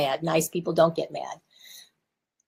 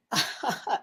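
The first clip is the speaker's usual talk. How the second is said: soft laugh; 3 semitones higher, 7 dB quieter than usual. Real, not acted. Laughs after thanking viewer.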